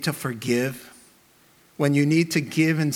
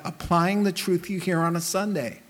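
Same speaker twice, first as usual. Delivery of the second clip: ordinary talk, nothing marked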